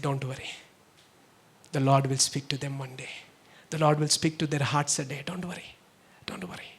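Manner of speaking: low, soft comfort sinking to a whisper; gentle reassurance. Retold or imitated. Pastor comforts a troubled family, soft slow reassurance. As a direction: reassure them very softly and slowly.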